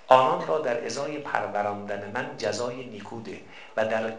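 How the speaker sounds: soft, low reading of a supplication; quiet prayer recitation. Acted. Preacher reciting a prayer for parents, softly.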